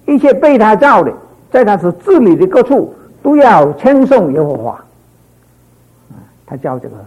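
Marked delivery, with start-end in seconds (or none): 0.1-4.7 higher and louder verse reading; scripture proclamation. Acted.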